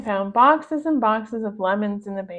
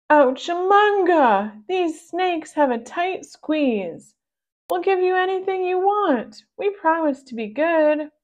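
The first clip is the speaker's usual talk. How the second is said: squeezed pug voice; 8 semitones higher, 5 dB louder than usual. Acted. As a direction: squeak it out like you're being squeezed tight. Pugs squeezed by snakes.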